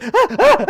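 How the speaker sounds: high, loud short monkey cries; imitated baboon shrieks. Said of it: "shrieks go from shrieks of rage to".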